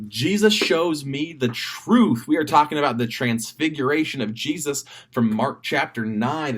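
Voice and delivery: ordinary talk, nothing marked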